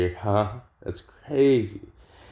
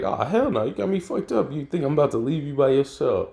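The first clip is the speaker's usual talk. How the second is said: much higher, indignant protest; Gohan protesting; 6 semitones higher than usual. Retold or imitated. Gohan refusing to let Yamcha fight alone, much higher. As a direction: push the pitch way up in outrage.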